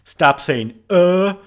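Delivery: hammed-up critic mocking the filler; mock complaint. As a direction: bark it like an annoyed listener. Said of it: "such as things like".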